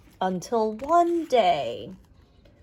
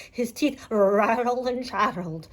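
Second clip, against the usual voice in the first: ordinary delivery, nothing marked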